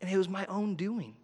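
softly admitting fault to himself; hushed, rueful self-talk; quiet realization. Retold or imitated. Pastor voicing his own thoughts, quietly rueful. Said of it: "I just start realizing".

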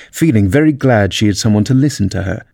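ordinary delivery, nothing marked

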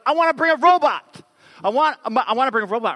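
put-on high, loud child's demand; whiny demand. Pastor imitating his daughter, whiny high demand. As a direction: imitate a six-year-old insisting on a robot.

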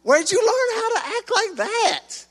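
mock parent scolding, rising high at the end; a parent scolding a wayward kid; exasperated parent. Preacher voicing a frustrated parent to the congregation, high and scolding. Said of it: "you're like".

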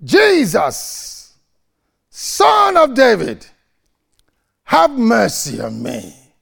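calling out loud and high to Jesus; retold desperate shout. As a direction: cry out for mercy, stretching Son of David. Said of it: "He shouted".

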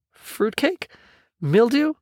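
high, rising one-word questions; incredulous echo. Acted. Kids balking at Gonzo's fruitcake and mildew, incredulous. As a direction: query each word in disbelief, pitch shooting up.

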